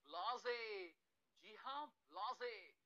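ब्लाजे said "bla-zay"; correct pronunciation. Shown as a demonstration. Teacher correcting ब्लेज to ब्लाजे. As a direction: say ब्लाजे, not blaze. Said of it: "ये है".

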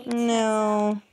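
ordinary delivery

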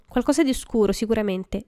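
ordinary delivery, nothing marked

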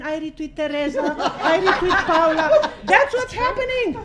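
laughing outrage at the echo chamber; outraged laughing rant. Real, not acted. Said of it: "I'm sorry I'm outraged".

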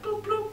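high-pitched imitated double bleep; car lock beep. Woman locking the car as she walks away, high beep noise. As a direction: imitate a remote locking with two high blips. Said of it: "hit the lock button".

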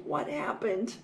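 ordinary delivery

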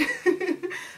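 a short high burst of laughter; laughing. Real, not acted. Vlogger excited about her video to viewers, laughing. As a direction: break into a quick excited laugh.

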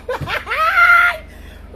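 very high, long laugh; shrieking cackle. Real, not acted. Mocking those who cuss back, shrieking cackle. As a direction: let out a high, squealing laugh. Gloating about cussing people out.

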